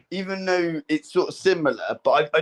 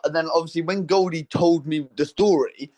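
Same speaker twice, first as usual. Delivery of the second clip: ordinary talk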